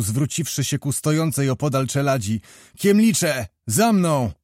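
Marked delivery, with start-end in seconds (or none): 2.8-4.3 raised, higher-pitched rallying call; shouting a command. A narrator's reading. Kmicic turns to his servants, shouting a command. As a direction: call your men to follow, short and forceful. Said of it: "krzyknął".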